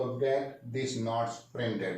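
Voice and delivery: drawn-out teaching read; very slow model sentence. Shown as a demonstration. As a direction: lay each word out slowly. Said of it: "तो".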